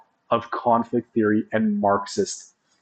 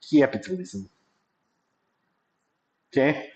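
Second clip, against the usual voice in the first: put-on lapse into a poor Russian accent; a hint of mock Russian accent; slightly off Russian accent on one word; bad Russian accent. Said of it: "said that with a Russian accent".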